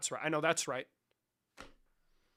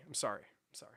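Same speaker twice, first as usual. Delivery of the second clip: fading whisper; 7 semitones lower, 10 dB quieter than usual. Acted. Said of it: "I'm trolling".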